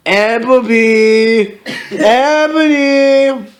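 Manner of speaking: long-drawn eerie name call; villain calling. Acted. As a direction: stretch the name out, rising on the repeat. Villain calls Ebony.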